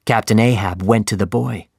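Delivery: usual speaking voice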